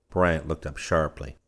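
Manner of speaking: ordinary delivery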